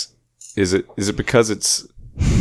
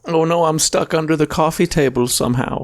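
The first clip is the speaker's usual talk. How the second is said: mock helpless voice; 8 semitones higher than usual. Acted stuck person.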